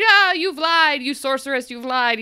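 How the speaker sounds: mock angry accusation. Ingrid storming out, accusing.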